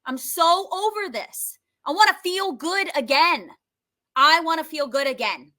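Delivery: high, emphatic venting; insistent frustration, pitched well up; angry, meaning it. Shown as a demonstration. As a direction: say it like you mean it. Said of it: "say it like you mean it".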